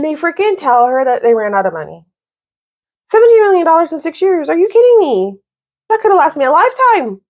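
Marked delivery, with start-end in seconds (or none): 3.1-7.2 high, fast incredulous outburst, pitched well up; can't believe the waste, let it burst out; outraged disbelief. Real, not acted. Vlogger ranting to viewers about the heroine's parents, outraged disbelief.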